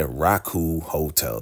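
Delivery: ordinary delivery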